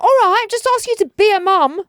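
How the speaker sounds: put-on high, loud sulky teen retort. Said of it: "She's like".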